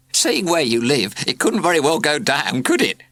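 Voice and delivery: high, loud cheeky joke; joking postman. A narrator's reading. Lingering postman jokes to the class, loud and cheeky. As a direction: crack the joke gleefully. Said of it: "cried the postman".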